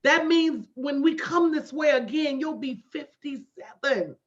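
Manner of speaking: ordinary talk, nothing marked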